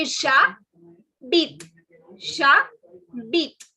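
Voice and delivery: j'habite broken as 'zha... beet'; slowly, j'habite opening on 'jha'; j'habite with silent h, in two clear beats; like Hindi jha. Shown as a demonstration. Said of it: "Like in Hindi, we have the word "jha".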